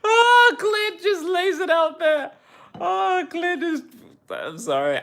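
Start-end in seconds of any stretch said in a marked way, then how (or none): 0.0-3.8 high, laughing voice; real laughter while reading a comment. Real, not acted. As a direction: talk through the laughter.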